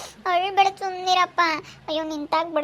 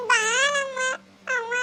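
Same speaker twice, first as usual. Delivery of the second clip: tearful call; 8 semitones higher than usual. Acted. Child calling out while crying.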